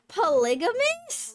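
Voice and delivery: high, disbelieving repeat; questioning it in disbelief; surprised. Real, not acted. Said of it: "usually pronounced something different".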